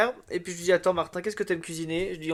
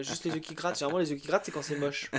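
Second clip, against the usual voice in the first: ordinary talk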